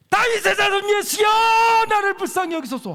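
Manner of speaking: 높고 크게 목청껏 부르짖기; 사람들 틈에서 주목을 끌려는 절규. Shown as a demonstration. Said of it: "난리를 쳐야 돼". (예수님 부르며 목청껏 외치는 맹인)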